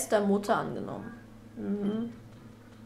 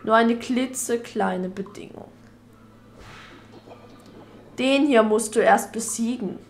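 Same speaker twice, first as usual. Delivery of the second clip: evil dark lord; 12 dB louder than usual. Acted. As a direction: gloat like a dark lord threatening the village. Villain taunts the hero.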